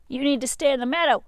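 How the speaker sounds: loud quick sheepdog rebuke; stern sheepdog. Acted. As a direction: tell them off firmly as the dog. Sheepdog scolds again.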